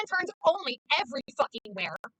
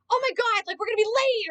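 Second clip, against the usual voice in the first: panicky friend; 7 semitones higher than usual. Retold or imitated. Stressed driver fretting about being late, panicky. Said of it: "they're like".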